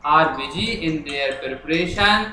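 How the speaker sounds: very slow, word by word; slow dictation. Shown as a demonstration. Teacher writing the letter on the board, slow dictation. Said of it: "अब मैं लिखना शुरू कर रहा हूँ".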